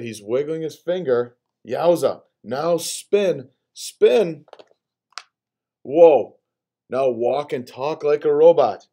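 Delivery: put-on lively, animated read-aloud for kids; playful book character voice. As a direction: play the bossy book with gusto. Reads picture book aloud.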